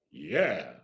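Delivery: low, drawn-out cocky yes; bluffing assurance. Retold or imitated. Kid asked if they can act, bluffing assurance. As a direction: sink low to sound sure of it.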